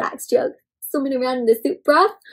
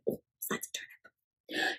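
whisper; 21 dB quieter, slower than usual. Real, not acted. Aside to child listeners.

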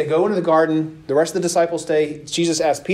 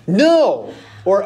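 normal talking voice

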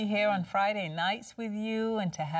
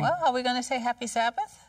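higher, louder and quick, inviting everyone in; upbeat greeting cue; 6 semitones higher, 3 dB louder, faster than usual. Real, not acted. Co-host cheerfully cues the panel's Sabbath greeting. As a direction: bounce the question out to the panel.

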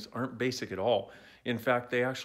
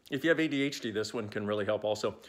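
ordinary delivery

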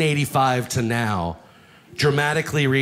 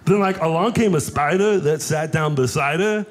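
lightly voiced Wreck-It Ralph; John C. Reilly voice. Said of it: "John C. Reilly from Wreck-It Ralph".

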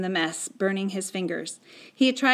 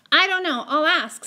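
put-on high, louder child's voice; boy's hurried answer; 9 semitones higher, 5 dB louder than usual. Boy answering Mum about Patrick, high-pitched reply. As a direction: answer Mum in a raised boyish voice.

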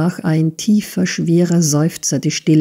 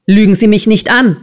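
fast, raised, angry voice; higher, hurried and furious; shouted accusation; 4 semitones higher, 4 dB louder, faster than usual. A narrator's reading. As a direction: snap it out as an irate shout. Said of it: "schrie Squeers".

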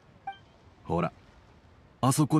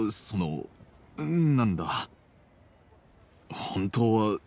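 hesitant; 4 semitones lower, slower than usual. Acted. Awkward father struggling to confess feelings.

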